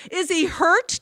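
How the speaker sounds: high-pitched, worried demand; anxious demand. A narrator's reading. Mother demands news of her son, high and anxious. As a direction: demand to know, frightened. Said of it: "demanded the mother".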